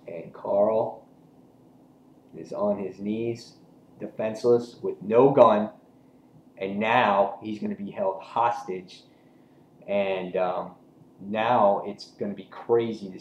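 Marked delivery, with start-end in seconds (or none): none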